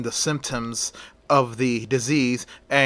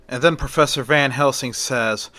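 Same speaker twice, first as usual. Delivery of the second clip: plain reading voice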